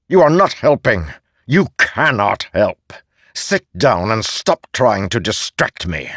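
cold, cutting disdain; contemptuous god voice. Acted. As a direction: dismiss him as useless. Ishar belittles Kaladin.

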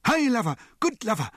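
high, loud screamed praise; excited scream. A narrator's reading. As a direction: cheer your dog on wildly. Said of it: "screamed Mister T".

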